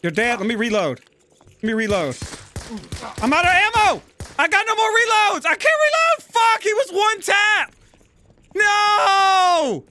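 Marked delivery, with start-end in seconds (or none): 3.2-7.7 high, loud frantic shouting; panicked yelling. Real, not acted. Player out of ammo mid-fight, frantic high shout. 8.5-9.8 long high loud cry of defeat; anguished shout. Real, not acted. Player killed after running dry, anguished high shout. Loses the gunfight.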